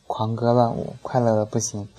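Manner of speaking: ordinary delivery, nothing marked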